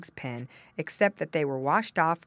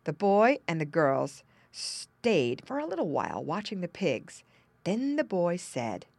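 usual speaking voice

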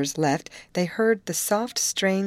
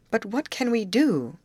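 beseeching; 7 semitones higher than usual. A narrator's reading. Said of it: "Grace besought".

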